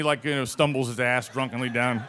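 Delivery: ordinary talk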